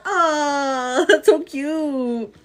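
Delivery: usual speaking voice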